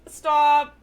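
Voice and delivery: sharp, loud stop; loud exclaimed stop. Real, not acted.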